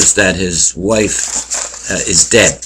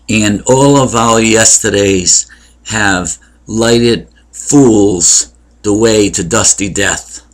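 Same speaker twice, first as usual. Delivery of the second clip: drawn-out, grave Shakespeare reading; slow solemn recitation; slower than usual. Acted. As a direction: stretch "lighted fools the way" very slowly. Recites Macbeth soliloquy.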